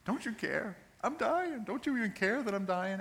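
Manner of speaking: high, urgent pleading; desperate, high-pitched acted appeal; panicked plea. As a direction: cry out as if abandoned. Acted cry of the disciples.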